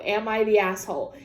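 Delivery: ordinary talk, nothing marked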